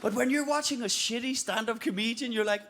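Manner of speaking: usual speaking voice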